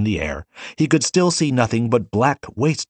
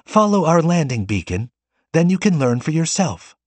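put-on higher, cheerful girl; happy; 8 semitones higher than usual. A narrator's reading. Girl cheerfully guiding the Forerunner to the beacon. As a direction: brighten up, inviting. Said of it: "came the happy reply".

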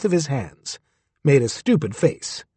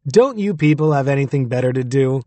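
usual speaking voice

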